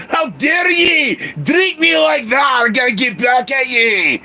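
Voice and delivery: high, slow mock Scottish shout; Irish or Scottish milk. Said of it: "like, Irish or Scottish".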